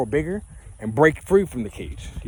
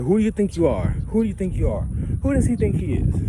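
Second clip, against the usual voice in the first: high, scolding parent voice, slowing at the end; a hammed-up scolding parent; mocking parents; 6 semitones higher than usual. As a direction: impersonate nagging parents. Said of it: "my parents was like".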